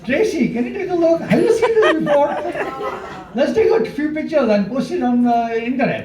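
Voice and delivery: playful over-the-top call to Tracy; mock excited call. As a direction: hype up the find, laughing. Teasing patient, calling assistant.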